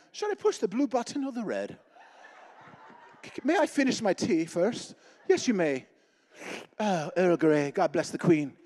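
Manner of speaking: hammed-up British accent, leisurely tea-time manner; British airman. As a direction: dawdle politely like a cartoon British bomber pilot. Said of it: "The British during the World War Two".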